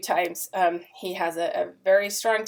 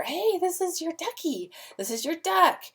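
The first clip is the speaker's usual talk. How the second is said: parentese; 8 semitones higher than usual. Shown as a demonstration. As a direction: coo it up high to a baby. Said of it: "Like this little dad right here".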